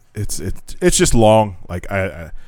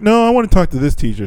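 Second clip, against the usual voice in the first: put-on kid voice; 9 semitones higher than usual. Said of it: "And he's like".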